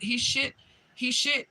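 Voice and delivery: can't get past the shock, voice climbing; stunned disbelief building. Real, not acted. Stammering the shock over and over.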